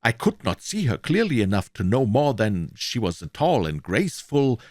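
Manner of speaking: ordinary delivery, nothing marked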